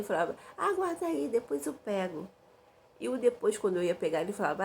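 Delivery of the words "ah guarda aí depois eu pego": high, sweet naive voice; mock innocent girlish brush-off. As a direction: play her younger trusting self lightly. Retelling her naive younger self.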